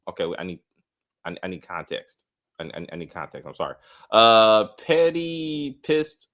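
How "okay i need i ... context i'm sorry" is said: low, soft puzzled mumble; quietly asking for context; baffled mutter. Real, not acted. Host quietly puzzling over a viewer comment. Reading confusing chat comment.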